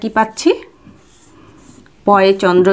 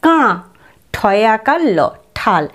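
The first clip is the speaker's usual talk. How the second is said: usual speaking voice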